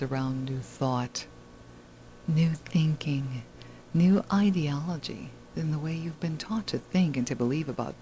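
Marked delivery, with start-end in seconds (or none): 2.3-5.3 whisper. Acted. Dramatic whispered show intro.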